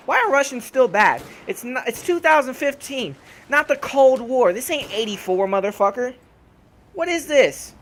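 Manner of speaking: exasperated rant. Real, not acted. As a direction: rant in disbelief at the cliché. Ranting about Russian villains.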